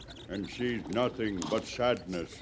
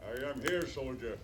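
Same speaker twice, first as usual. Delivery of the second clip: quiet reply; 3 semitones higher, 8 dB quieter than usual. Acted. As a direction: speak quietly, stepping out of hiding. Scout appears quietly from hiding.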